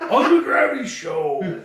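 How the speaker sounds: booming, high hype announcer laughing; monster truck announcer. Pretend. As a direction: laugh through a loud arena announcer. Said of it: "like, monster truck announcers".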